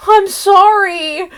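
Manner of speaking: high, slow, small I'm sorry; mock hurt apology. A daughter answering a yelling mom, mock hurt. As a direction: say it like a scolded kid. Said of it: "her mom, like, yells at her and she goes".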